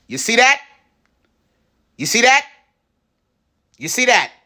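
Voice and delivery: proud, teasing repeat; excited showing off. Real, not acted. Showing viewers the chopped vegetables, excited and rising.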